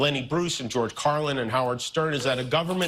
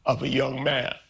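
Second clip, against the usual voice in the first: emotional, voice pitched higher after long halting pauses; choked up; 5 semitones higher than usual. Real, not acted. Host clarifying remarks about a murder, choked up. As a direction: fight back real emotion to get the words out. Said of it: "Growing emotional".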